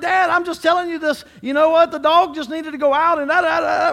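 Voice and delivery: put-on loud, high excited kid voice; daughter talking at a ten. Daughter telling dad about the dog, loud and high. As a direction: blurt it all out at full volume. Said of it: "they like to talk at a ten".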